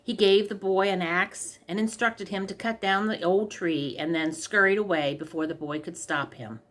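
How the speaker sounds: usual speaking voice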